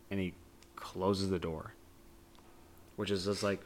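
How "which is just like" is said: usual speaking voice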